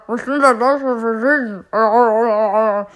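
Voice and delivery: loud, odd put-on genie voice. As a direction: boom in as a strange genie. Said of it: "Genie, ist das deine Stimme?".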